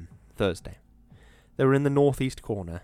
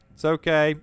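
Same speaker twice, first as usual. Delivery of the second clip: yelled, higher and louder; 7 semitones higher, 8 dB louder than usual. A narrator's reading. Hall yells back to the foreman, loud yell. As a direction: call back over the distance. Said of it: "Hall yelled".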